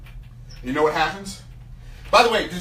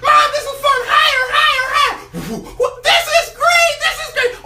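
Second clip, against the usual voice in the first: high, loud, laughing shouts; ecstatic baby eagle; 5 dB louder than usual. Acted. Eaglet cheers Mom to fly higher, high loud shouts. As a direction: kid screaming on a roller coaster.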